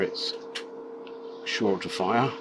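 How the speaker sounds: ordinary talk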